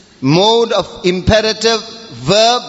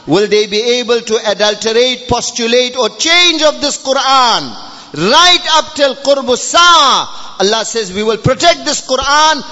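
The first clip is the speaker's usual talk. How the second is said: raised, ringing sermon delivery; full-force preaching; 4 semitones higher than usual. Real, not acted. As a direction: proclaim it forcefully to the congregation.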